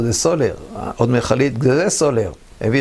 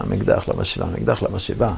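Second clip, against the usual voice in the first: ordinary delivery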